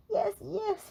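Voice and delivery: high, hurried cry; a high anxious cry. Acted. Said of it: "he cried".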